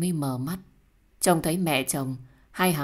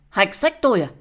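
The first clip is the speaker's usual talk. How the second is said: loud, raised-pitch outrage; indignant challenge; 4 semitones higher, 8 dB louder than usual. Acted. Challenging the other in a quarrel, indignant. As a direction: lash out, louder and higher.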